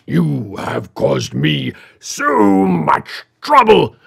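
slow, booming big bad villain proclamation; big bad evil guy's grand speech; dramatic villain. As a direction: draw it out loudly and theatrically like a gloating villain. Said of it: "In his deep, resonant voice, he dramatically says".

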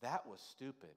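the mocking voice in your head, hushed and low; inner critic. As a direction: mutter the put-down to yourself. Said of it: "you start hearing those voices".

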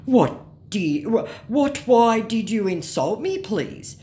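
mock wounded scammer, slow and halting. Scammer protesting at being called fake, mock hurt. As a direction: act out an offended scammer pleading. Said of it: "said our scammer".

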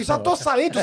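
high-pitched mock panic; panicked reply. Caught man answering from the toilet stall, mock panicked.

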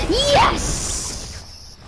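high, unvoiced cheer of joy; excited cheer. Real, not acted. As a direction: cheer as you win. Revived in game.

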